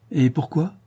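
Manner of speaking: high-pitched, mildly cross question; annoyed objection. A narrator's reading. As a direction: object with a touch of anger. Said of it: "fâchée".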